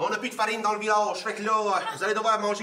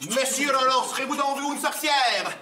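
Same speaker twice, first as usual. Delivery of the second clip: medieval villager; 7 semitones higher, 5 dB louder, slower than usual. Acted. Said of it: "époque médiévale".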